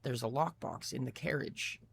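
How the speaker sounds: softly, slowly, a frightened captive; barely above a breath, slow; soft reply. Acted. Bound driver answering the knife-wielding boy, softly. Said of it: "in turn, softly".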